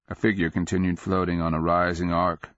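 ordinary delivery, nothing marked

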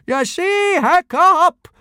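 very high, loud, triumphant cry; gleeful bellow. A narrator's reading. Teacher crowing to pupil after the demonstration, bellowing. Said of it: "he bellowed in between great guffaws".

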